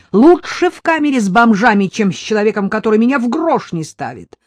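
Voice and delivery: loud, high angry yell; wife yells back in fury. A narrator's reading. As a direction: shout it at her husband. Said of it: "заорала Надежда".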